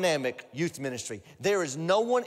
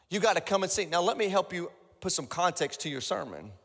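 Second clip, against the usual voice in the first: normal talking voice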